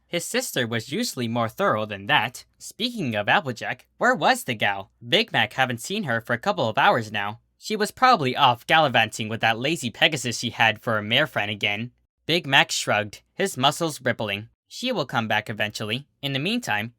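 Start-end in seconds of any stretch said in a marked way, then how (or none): none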